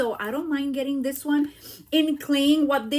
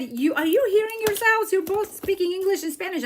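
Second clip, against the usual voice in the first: higher, louder mock disbelief; imitating amazed friend; 8 semitones higher, 4 dB louder than usual. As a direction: play the friend, amazed at what she hears. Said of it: "And she said".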